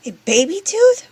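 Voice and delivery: high, loud puzzled rise; surprised question. Acted. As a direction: ask it high and amazed.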